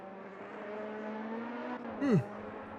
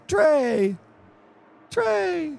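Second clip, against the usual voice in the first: excited call. Real, not acted. Calling out driver's name excitedly.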